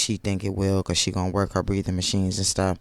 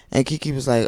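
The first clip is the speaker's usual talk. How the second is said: normal talking voice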